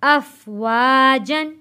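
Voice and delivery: "अफवाजन" read slowly as one word; joined recitation. Shown as a demonstration. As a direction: slowly link the whole word. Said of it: "जीम दो ज़बर जन". ("अफवाजन" जोड़कर धीरे)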